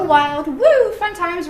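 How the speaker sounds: high, loud woo of fun; drawn-out, excited party cheer; excited whoop. Shown as a demonstration. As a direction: whoop it up playfully. Describes the dog going wild.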